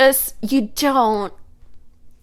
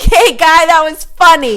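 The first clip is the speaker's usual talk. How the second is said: false encouragement; 5 semitones higher, 5 dB louder than usual. Acted. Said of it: "ask a comedian who didn't get a laugh".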